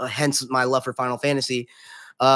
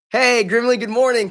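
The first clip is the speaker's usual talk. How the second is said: excited greeting; 8 semitones higher, 6 dB louder than usual. Real, not acted. Greeting a viewer joining stream.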